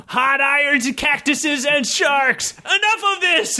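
high exasperated cut-in; high fed-up interruption; exasperated outburst. Acted. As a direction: break in fed up. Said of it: "interrupted Rip".

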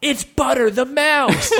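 high, excited shout. Acted. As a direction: shout out in delight. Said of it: "shouted Dagger".

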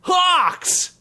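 high yelled summons; high yelled call; yelled command. Acted. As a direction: call the hawks in with a high yell. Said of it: "added the Beastmaster".

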